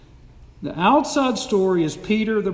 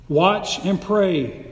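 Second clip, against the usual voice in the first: slow solemn warning; much slower than usual. Acted. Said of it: "and he said".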